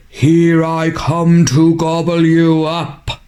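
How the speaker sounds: mildly bellowed troll threat; troll announcing an attack, understated bellow. A narrator's reading. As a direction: bellow it lightly, like a storybook troll.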